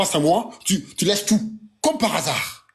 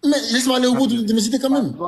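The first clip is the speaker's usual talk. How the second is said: pitched up, annoyed, fighting to speak; heated insistence, higher; 7 semitones higher, much faster than usual. Real, not acted. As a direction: insist on finishing over the others. Insiste, agacé, dispute. (il insiste pour finir, voix haute et agacée)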